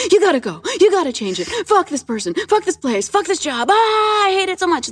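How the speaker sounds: high, urgent ranting; insane ego voice. Acted. Guest acts out the ego in our head, manic and insane. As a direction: rattle off frantic outbursts, pitched well up. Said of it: "that insane ego voice".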